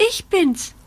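high small-animal voice; little rabbit calling. A narrator's reading. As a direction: pipe up as a little bunny. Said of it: "ruft das kleine Kaninchen".

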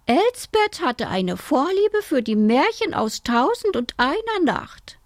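high-pitched chatty aunt; aunt's voice. A narrator's reading. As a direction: explain it in a high auntly voice. Aunt explains the bird's name.

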